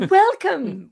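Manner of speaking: mock gushing greeting, high and loud; hammed-up welcome, pitched right up; effusive welcome. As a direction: greet with over-the-top enthusiasm. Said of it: "'cause we didn't go".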